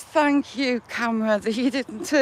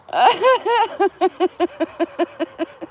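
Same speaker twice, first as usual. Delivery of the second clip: teary breathless laugh; real laughing till crying; crying laughter; 3 semitones higher than usual. Real, not acted. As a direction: laugh through tears. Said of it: "I'm crying".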